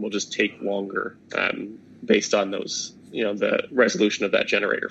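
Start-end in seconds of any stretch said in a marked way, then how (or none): none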